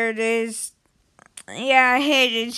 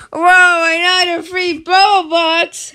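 excited shout; 3 semitones higher, 7 dB louder than usual. Real, not acted. Gamer spotting free Robux, loud excited shout. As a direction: shout with sudden greed and glee.

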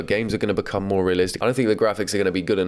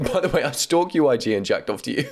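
acted fit of laughter, pitched up; laughing till crying; 5 semitones higher than usual. Someone texting a crush, trying to take the sting out, laughing till crying. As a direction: read it as if cracking up at your own joke. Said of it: "he's either laughing so much that he's crying".

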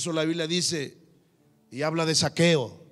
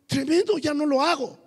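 surprised joy; 9 semitones higher than usual. Retold or imitated. Joyful realization retold.

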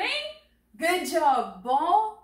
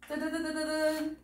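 quick sung da-da-da in a higher pitch; vocal drumroll; 4 semitones higher, 4 dB quieter, faster than usual. Pretend. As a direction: imitate a suspense drumroll, rapidly. Vocal drumroll before the answer.

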